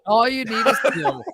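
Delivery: laughing while repeating the title. Real, not acted. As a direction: chuckle through the words.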